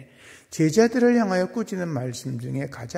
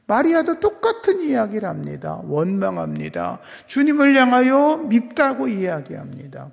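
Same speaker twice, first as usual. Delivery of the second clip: normal talking voice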